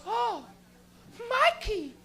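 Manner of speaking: put-on high, slow Portuguese accent, revelation; Portuguese mother with a heavy accent, realising her son smokes weed; Portuguese mom. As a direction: piece it together like a revelation. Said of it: "she goes".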